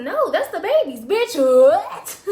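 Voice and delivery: shocked loud what. Real, not acted. Hearing baby heartbeat shock.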